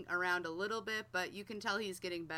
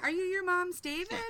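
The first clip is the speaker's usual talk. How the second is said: put-on high, loud, rising baby talk to the cat; cooing to the cat; 10 semitones higher, 6 dB louder than usual. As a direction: coo it at the cat like a pet. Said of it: "So I'm like".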